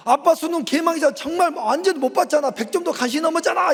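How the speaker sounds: mocking son. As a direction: rattle off the taunt like a cheeky teen. Son mocking father's exam. (빠르게 놀려대는 아들 흉내)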